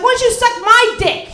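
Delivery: high, loud comeback; yelling back. Retold or imitated. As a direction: shout it back at him. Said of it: "I'm like".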